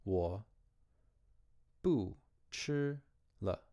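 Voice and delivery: each syllable said apart, slowly; slow syllable-by-syllable Chinese. Shown as a demonstration. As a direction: break 我不吃了 into single slow syllables.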